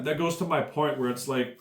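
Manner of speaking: usual speaking voice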